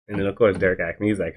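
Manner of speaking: normal talking voice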